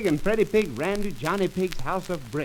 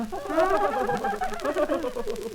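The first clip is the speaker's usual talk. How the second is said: high squeaky giggling; pigs laughing; 10 semitones higher than usual. Acted. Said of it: "How the three little pigs laughed".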